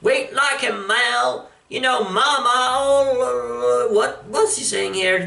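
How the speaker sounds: put-on high, halting hillbilly accent; a hint of hillbilly accent, stumbling. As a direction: fumble the line in a hillbilly accent. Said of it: "some kind of hillbilly accent".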